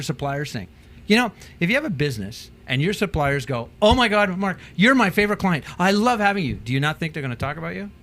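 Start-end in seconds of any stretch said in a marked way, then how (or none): 3.8-6.5 loud, high, delighted supplier praising a client; gushing supplier. Retold or imitated. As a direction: gush over your favourite customer. Supplier gushing about client Mark.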